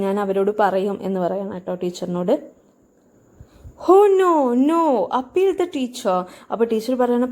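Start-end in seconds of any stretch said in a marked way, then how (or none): 3.9-6.1 a lightly voiced pleading teacher, higher; mock-frantic plea, pitched up; begging teacher. Teacher in the story begging the boy not to tell, mildly pleading.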